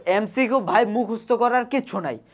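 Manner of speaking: ordinary delivery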